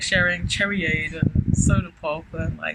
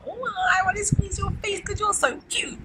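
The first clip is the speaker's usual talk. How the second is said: 1 gushing adoration. Pretend. Voicing gushing adoring partner.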